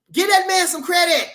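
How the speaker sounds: high, loud demand; emphatic shout. Real, not acted. Hyped streamer demanding credit for his fighter, emphatic shout.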